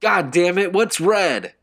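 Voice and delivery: very loud, high angry yell; furious yell. A narrator's reading. Will yelling down, furious. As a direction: lose patience and bellow. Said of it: "Will yelled insistently".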